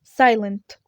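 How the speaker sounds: ordinary delivery, nothing marked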